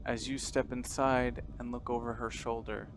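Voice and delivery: measured, hushed horror narrator; quiet, deliberate game master storytelling; scene narration. Acted. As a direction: set an eerie scene with long pauses.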